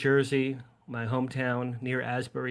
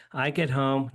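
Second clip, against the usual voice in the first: ordinary delivery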